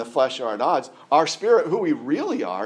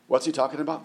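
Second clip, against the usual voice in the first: normal talking voice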